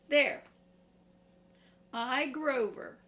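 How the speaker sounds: loud proud puppet declaration; Grover proclaiming. Acted. Grover proudly fixes the pages, loud. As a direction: announce it proudly.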